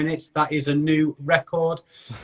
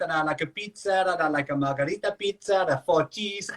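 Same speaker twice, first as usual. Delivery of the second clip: playful put-on Italian accent, raised pitch; 4 semitones higher, 4 dB quieter than usual. Host jokes for viewers in a mock Italian accent. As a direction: ham up an Italian accent about pizza. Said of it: "I have, like, an Italian accent".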